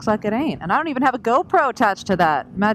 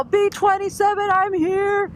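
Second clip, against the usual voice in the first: triumphant arrival; 13 semitones higher, 4 dB louder, slower than usual. Retold or imitated. Arriving at the gate running.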